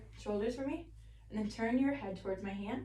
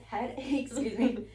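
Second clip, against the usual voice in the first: laughing; 3 semitones higher, 3 dB louder, slower than usual. Real, not acted. Stumbles and laughs at slip.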